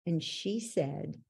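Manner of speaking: usual speaking voice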